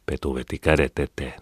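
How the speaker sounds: ordinary delivery, nothing marked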